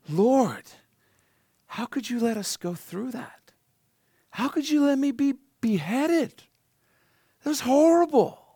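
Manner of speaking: high, whiny complaint to God; hammed-up whining protest, pitched high; mock whining. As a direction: whine it like a sulking child. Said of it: "they're not whining".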